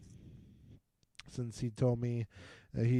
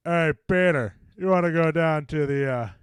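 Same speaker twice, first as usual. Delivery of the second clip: Patrick Warburton as Joe. Pretend. Friend asks to hear Joe, so a hearty Joe voice calls out. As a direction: hail your buddy Peter for a trip to the bar. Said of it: "really good Patrick Warburton impression".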